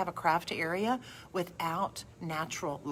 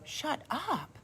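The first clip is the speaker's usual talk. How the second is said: light, high teasing "Shut up"; playful high shut up; 9 semitones higher, 7 dB quieter than usual. Shown as a demonstration. Friend teasing a friend: playful high shut up. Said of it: "I'm saying it like".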